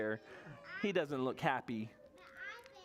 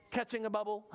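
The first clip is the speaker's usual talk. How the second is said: ordinary delivery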